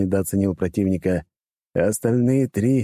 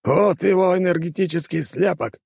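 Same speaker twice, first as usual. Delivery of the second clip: villain voice; 11 semitones higher than usual. Acted. Villain hands over orb.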